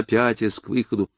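usual speaking voice

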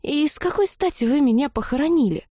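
put-on young girl confused why friends mourn her; puzzled heroine. A narrator's reading. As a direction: ask it half offended. Kira voiced, puzzled.

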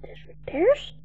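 whispered question, rising at the end; scared boy whispering the words back; frightened whisper. Acted. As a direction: echo him under your breath. Boy echoes the rabbi in fear.

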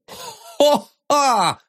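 high mocking ho-ha; drunken scornful laugh. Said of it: "ответил Ванзаров".

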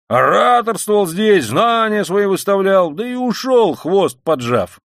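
loud, high, drawn-out ranting; tipsy shouting. A narrator's reading. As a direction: rant boisterously, stretching key words. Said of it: "закричал хмельной Разумихин". (хмельно, крикливо, нараспев)

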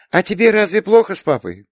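put-on small girl prying, a touch shy; bashful little girl. A narrator's reading. Little girl asks aunt about papa, bashfully curious. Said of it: "будто стыдясь своей пытливости".

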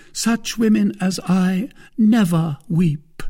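high, loud, proud denial; Milady, haughty. A narrator's reading. Lady rejecting suitor's sympathy, high and haughty. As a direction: declare yourself above tears.

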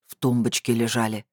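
low, tight-lipped statement; mildly menacing male character; Vladimir, through teeth. A narrator's reading. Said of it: "процедил Владимир".

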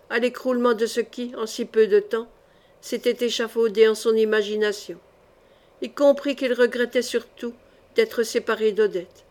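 usual speaking voice

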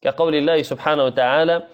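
usual speaking voice

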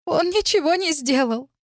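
high, tearful plaint; tearful repeating. A narrator's reading. Girl grieving for her brother, tearful. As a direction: repeat it high, slowing, swallowing tears. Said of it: "глотая слёзы".

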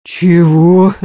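sharp disbelieving question; can't believe it. A narrator's reading. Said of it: "не поверил Ефим".

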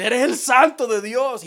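the demon testifying to Jesus, voiced; demon's declaration. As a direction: proclaim it slowly as the demon. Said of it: "le dice".

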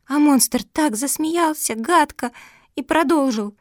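ordinary delivery

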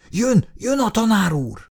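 high call of jön, jön; calling out warning. Acted. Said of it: "elkiáltotta magát". (elkiáltva, figyelmeztetően)

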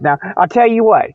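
normal talking voice

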